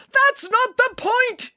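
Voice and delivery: very high, slow, strained shout; drawn-out outraged cry; angry yelling. A narrator's reading. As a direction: yell it in exasperation. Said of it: "yelled Mister Pilcher".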